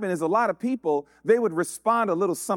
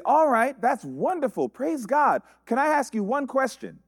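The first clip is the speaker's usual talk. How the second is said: bright cheerful greeting; 7 semitones higher than usual. Retold or imitated.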